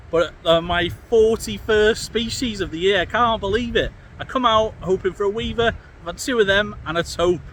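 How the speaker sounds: excited. Real, not acted. Thrilled after catching a tope.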